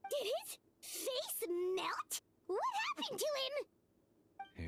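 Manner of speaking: gasp, then slow, high horrified question; stunned, drawn-out disbelief; shocked gasp. Acted. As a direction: recoil at the sight and ask slowly. Shocked at the melted face.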